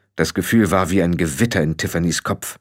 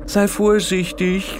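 cautioning; 10 semitones higher, slower than usual. A narrator's reading. Startled warning to jumping Tiffany.

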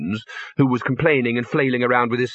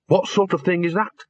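higher-pitched puzzled question; mystified; 6 semitones higher than usual. A narrator's reading. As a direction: ask it, genuinely baffled.

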